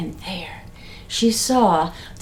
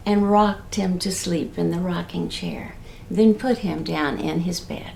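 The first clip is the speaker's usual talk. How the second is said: ordinary delivery, nothing marked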